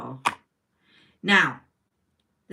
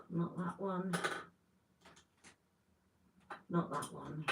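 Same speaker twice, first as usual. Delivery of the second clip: very soft, to herself while sorting; hushed, distracted self-talk; absent murmur; 12 dB quieter than usual. Real, not acted. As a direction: mutter it barely aloud as you reject each item. Sorting through liners to herself.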